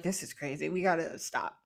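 low, soft, worn-out mock voice; exhausted. Two gods worn out from fighting each other, exhausted. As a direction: play two fighters too tired to go on. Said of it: "they're both exhausted".

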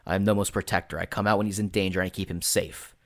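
usual speaking voice

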